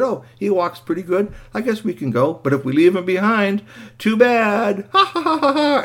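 put-on high boy's voice rising into a taunting laugh; mocking boy. Boy ready to leave the robot behind, gleefully mean.

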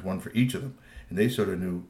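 plain reading voice

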